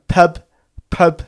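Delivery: put-on a toddler saying pub in a light northern accent. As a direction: mimic the baby's little northern pub. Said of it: "it's got a little northern accent".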